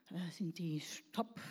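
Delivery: mock whisper. Jesus musing about choosing disciples.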